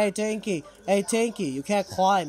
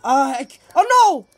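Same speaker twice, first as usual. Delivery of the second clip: loud, high cry of alarm; stammer, then shriek in alarm; panicked; 5 semitones higher, 10 dB louder, faster than usual. Real, not acted. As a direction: blurt it out in sudden panic. Monster reappears while player flees.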